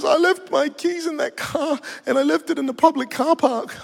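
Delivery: high, whining imitation of a weeping confession; put-on crying voice; mock tearful. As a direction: confess it as a sobbing caller would. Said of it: "She's in tears".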